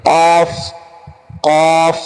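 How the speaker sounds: কফ said throaty, Arabic qaf; from the throat. Shown as a demonstration. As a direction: push কফ up from inside the throat. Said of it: "একটু গলার ভিতর থেকে".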